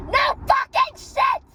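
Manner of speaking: high, loud, slow exasperated yell; furious shout. Real, not acted. Shouting at the workers' obvious diagnosis, loud furious yell. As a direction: explode at their obvious answer.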